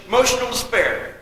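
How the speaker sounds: normal talking voice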